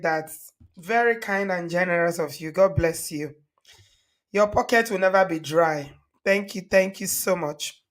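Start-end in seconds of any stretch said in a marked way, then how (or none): none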